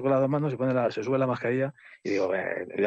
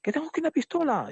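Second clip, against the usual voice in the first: high-pitched imitation of an agitated aggressor; mock threat. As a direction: mimic a stranger's threat, voice way up. Retold armed threat from stranger. (amenaza imitada, con voz muy aguda)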